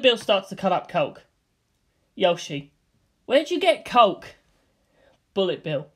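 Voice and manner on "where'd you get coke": sharp, high-pitched surprise; a startled, rising demand; surprised. Acted. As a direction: catch him off guard with it. Character surprised by the coke.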